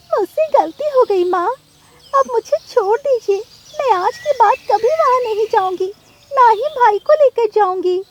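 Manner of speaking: put-on high, loud child-bird begging; tearful pleading. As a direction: plead to be let go, pitched very high. Child begs during beating. (रोते हुए ऊँची आवाज़ में गिड़गिड़ाना)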